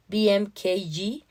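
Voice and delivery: ordinary delivery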